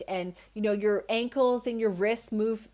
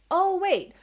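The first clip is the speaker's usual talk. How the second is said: high-pitched interrupting worry; little worrying voice; 10 semitones higher, 5 dB louder than usual. Acted. Worrying inner voice breaking into her train of thought, high alarm. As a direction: pipe up suddenly, high, as the worry. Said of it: "there's that little voice again".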